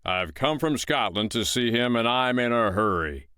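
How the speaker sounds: a lightly voiced giant in a hurry; gruff giant. Giant telling the woman he came from Scotland, impatient.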